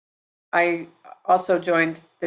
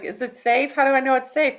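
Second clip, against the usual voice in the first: mock-anxious voice, pitched up; retold worried question; 8 semitones higher than usual. Mother retells her daughter questioning the shot, higher and worried. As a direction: imitate her asking, higher-pitched and doubtful.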